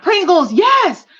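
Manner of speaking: triumphant yelp of remembering; excited recall. Real, not acted. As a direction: burst out as the name finally comes to you. Finally recalls the chip name.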